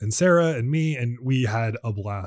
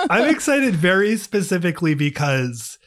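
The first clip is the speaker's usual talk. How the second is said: higher, laughing through the words; 4 semitones higher than usual. Real, not acted. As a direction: press on with the point through real laughter. Laughs at co-host's interruption.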